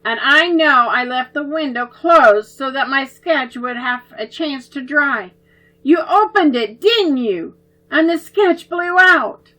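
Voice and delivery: stern accusation. Acted. Oliver confronting the twins over the sketch, stern. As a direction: press them for the truth.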